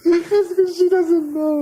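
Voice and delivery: slow, high, teasing sing-song; mocking high voice. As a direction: taunt her in a high slow voice.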